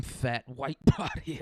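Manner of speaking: ordinary talk, nothing marked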